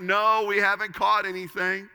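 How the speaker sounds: yelling back. Retold or imitated. Fishermen shouting back to the shore, loud reply. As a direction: holler it across the water. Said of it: "And they yell back".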